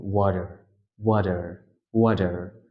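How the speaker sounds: ওয়াটার as "wa-der", T turned to D; American flapped T. Shown as a demonstration. Teacher showing how Americans say water, flapped T. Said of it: "টিটাকে kind of ডি এর মতো উচ্চারণ".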